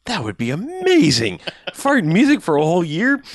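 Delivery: high-pitched, thrilled gushing; hammed-up giddy hitchhiker; mock delight. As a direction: revel in a ridiculous idea.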